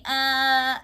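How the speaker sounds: ordinary delivery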